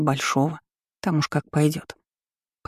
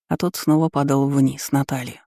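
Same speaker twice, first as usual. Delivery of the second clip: ordinary delivery, nothing marked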